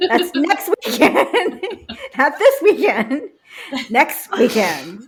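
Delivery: slow, laughing insistence. Real, not acted. To her friends, laughing while retelling the argument. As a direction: laugh through the words, stretching them.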